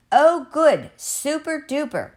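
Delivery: high-pitched gleeful exclamation; excited cry. Acted. Mr. Matu as Finn enjoys the tomato, gleeful cry. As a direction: cry it out with delight, voice lifted high. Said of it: "cried Mister Matu".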